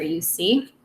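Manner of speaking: ordinary talk, nothing marked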